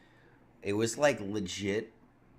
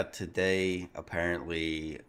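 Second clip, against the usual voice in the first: normal talking voice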